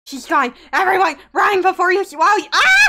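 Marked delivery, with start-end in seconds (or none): 2.5-2.9 startled shriek. Real, not acted. Startled on the ride.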